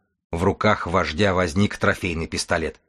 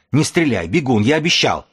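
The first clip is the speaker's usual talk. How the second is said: high, loud pleading cry; urgent shout; 6 semitones higher, 5 dB louder than usual. Acted. Tim shouts at the runner not to shoot, high and loud. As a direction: call out to stop the gun. Stopping a shot at gunpoint.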